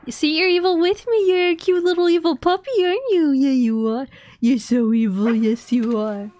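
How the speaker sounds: loud, high-pitched cooing at a puppy; doting pet talk. Pretend. Streamer fussing over the game's evil dog, doting pet talk.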